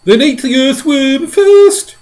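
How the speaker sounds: high, loud, slow frantic shout; shouting centipede. A narrator's reading. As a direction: yell it to save his own skin. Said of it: "shouted the centipede".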